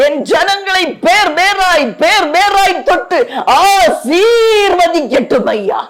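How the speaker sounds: high, sustained crying out to God; fervent prayer. Real, not acted. Preacher asking God's hands to bless each person, fervent and high. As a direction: pray at high pitch, pressing onward without letting up.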